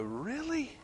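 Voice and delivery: high, soft incredulous "Really?"; mock disbelief. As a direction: throw up your hands in disbelief. Exasperated hand over head.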